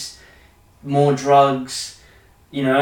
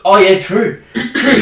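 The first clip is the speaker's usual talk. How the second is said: loud, high laughing "true"; laughing agreement; 6 semitones higher, 8 dB louder, slower than usual. Real, not acted. Agreeing with his mate through a loud laugh. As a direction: burst out laughing as you agree.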